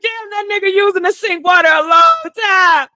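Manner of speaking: loud annoyed shout at his long shower; irritated yell; exasperated outburst. Retold or imitated. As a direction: blurt it out, fed up. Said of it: "I was like".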